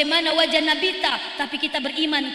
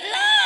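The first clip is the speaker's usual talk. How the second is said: sharp high no; emphatic no; 5 dB louder than usual. Acted. The Prophet firmly corrects his companions with a high no. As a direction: fling out the no.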